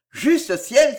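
startled lover's outburst, lightly acted; alarmed exclamation. A narrator's reading. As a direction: exclaim in mild shock. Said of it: "s'écria-t-il en se levant".

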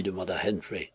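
ordinary delivery, nothing marked

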